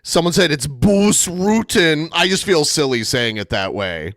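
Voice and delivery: ordinary delivery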